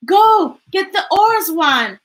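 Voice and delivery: loud, high urgent order; father yelling at his son to hurry. A narrator's reading. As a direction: bark the order at the boy. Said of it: "Papa yelled".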